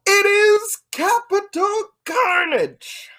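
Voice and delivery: big, high, drawn-out announcer shout; show announcer. Pretend. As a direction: announce the event loud and slow. Announcing the pay-per-view title.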